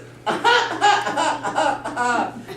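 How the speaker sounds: high-pitched pretend wailing sobs; hammed-up little girl's boo-hoo; mock crying. Little girl finds her soup eaten, mock bawling. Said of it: "the little girl's like this".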